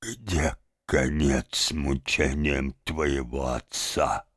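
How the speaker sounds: low, slow, soft lament; subdued lament. A narrator's reading. Old father laments his torments to Benchik, subdued lament. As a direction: lament wearily in a small voice.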